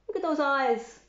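ordinary delivery

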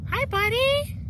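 high, bright hello to a cow; cheery farmer voice. Pretend. Teacher voicing Mr Wetzel to cow.

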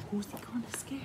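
breathy hushed whisper, rising end; suspense whisper. Acted. Reader building suspense for children with a whisper. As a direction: hush them into suspense. Repeated suspense question, softer.

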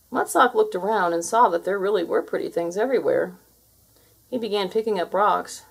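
ordinary delivery

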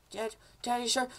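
put-on high, soft kid voice; whiny son. Son asking dad to go in, whiny kid voice. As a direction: plead in a high little son voice.

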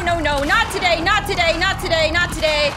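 loud, high, rapid-fire panic; real gamer panic under attack; panicked pleading. Real, not acted. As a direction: repeat it frantically, pitch sliding down then spiking.

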